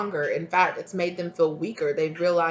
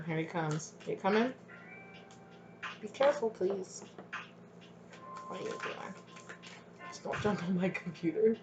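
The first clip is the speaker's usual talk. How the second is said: soft coaxing; 11 dB quieter than usual. Real, not acted. Coaxing the cat onto desk.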